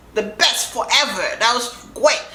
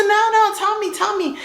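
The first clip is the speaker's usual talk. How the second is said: very high, insistent plea; imitating herself pleading to be told; eager begging; 14 semitones higher than usual. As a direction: beg in a squeaky high voice. Retold begging for the secret.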